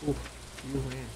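talking through the hiccups; quiet hiccupy words after a burp. Real, not acted. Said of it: "why are you hiccuping".

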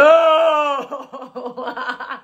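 loud burst of laughter; laughing. Real, not acted. Host laughing at a viewer's no. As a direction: laugh out at the reply.

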